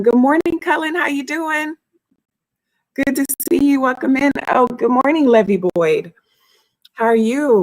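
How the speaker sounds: high, bright-eyed welcome; bubbly greeting. Real, not acted. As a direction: call out a cheery hello. Greeting a viewer on stream.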